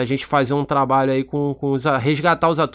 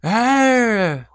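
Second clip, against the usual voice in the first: long, higher-pitched mock monster roar; beast's roar; 7 semitones higher than usual. Shown as a demonstration. Pitching the film's enemy beast, drawn-out roar. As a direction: draw out a beast's cry. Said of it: "vai vir e vai falar".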